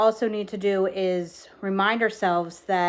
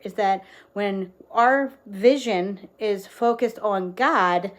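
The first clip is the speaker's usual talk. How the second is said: ordinary talk, nothing marked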